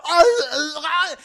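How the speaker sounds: high, loud strangled cries of pain. Retold or imitated. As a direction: cry out as you're being jumped. Fat guy attacked, crying out.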